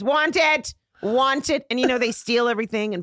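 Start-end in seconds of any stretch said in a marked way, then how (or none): 0.0-1.7 put-on high toddler demand. Little daughter demanding things in a store, high toddler voice. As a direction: imitate a little girl insisting. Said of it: "she'd just go like this".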